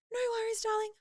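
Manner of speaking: high sing-song no worries darling; cheery receptionist. Retold or imitated. Receptionist breezily greeting her anxious patient, high and sweet.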